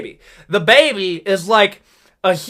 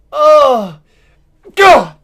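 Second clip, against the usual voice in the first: frustrated groan; 6 semitones higher, 7 dB louder than usual. Acted. Frustrated outburst noises.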